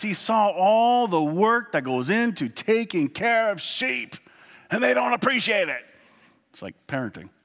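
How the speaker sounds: loud, fervent sermon delivery; passionate preaching. Acted. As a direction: preach it with full conviction. Preaching about David and sheep.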